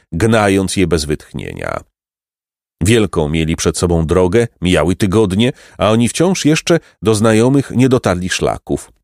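ordinary delivery, nothing marked

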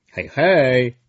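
loud cheerful hail from afar; calling out. Acted. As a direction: call out to a friend across the yard. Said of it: "zawołał Fikander". (Fikander woła głośno do Brąby)

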